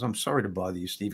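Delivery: low and soft, not wanting to intrude; imitated gentle apology. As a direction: play a stranger apologizing carefully. Stranger gently apologizes to seatmate.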